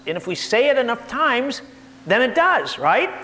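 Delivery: getting fired up over the claim; worked up. Real, not acted. Speaker heats up mocking the repeated dinosaur claims, worked up. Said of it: "I'm getting a little worked up".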